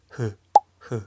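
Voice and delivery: "j" as a short hu, lips blowing almost an f; airy Japanese "j" syllable, between hu and fu; H nearing F. Shown as a demonstration. Teacher showing the Japanese U case, H nearing F. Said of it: "un poco más F".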